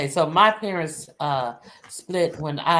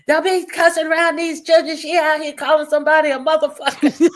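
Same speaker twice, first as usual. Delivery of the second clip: high, louder scolding mother with a laugh; mild mock scolding, raised pitch; fussing mother; 10 semitones higher, 4 dB louder than usual. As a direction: scold like a mom fussing at dad. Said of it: "she started fussing at my father".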